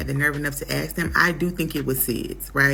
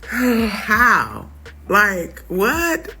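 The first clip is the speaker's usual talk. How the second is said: stunned disbelief; 4 semitones higher, 5 dB louder, much slower than usual. Real, not acted. Disbelief at the introduction.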